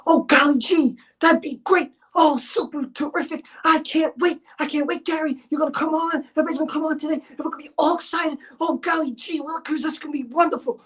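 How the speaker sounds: high, giddy golly-gee voice; crazy hyper Rob. Retold or imitated. Gary mocks Rob's gushing on the phone, high and giddy. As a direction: squeal it like an overexcited kid. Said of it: "he's all super crazy in the head".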